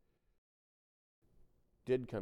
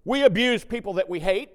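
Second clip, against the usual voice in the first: loud, higher declaration; preaching at full force; 9 semitones higher, 13 dB louder than usual. Acted. Preacher warning congregation about mockery, loud. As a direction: drive the point home.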